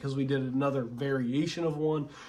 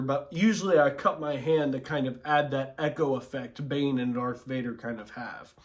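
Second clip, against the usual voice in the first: normal talking voice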